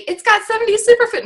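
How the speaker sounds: high, loud parody of a bland pitch; mocking a generic pitch, high and loud; mock sales pitch. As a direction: ham up a clueless salesperson. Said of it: "don't just say".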